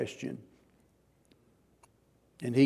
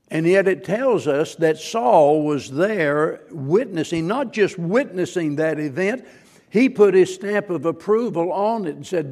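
louder and higher, pressing; preaching at full force; 3 semitones higher, 6 dB louder than usual. Acted. Preacher telling the church Saul approved, loud and rising. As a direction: drive the point home.

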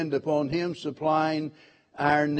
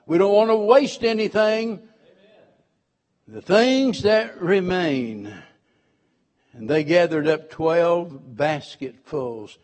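normal talking voice

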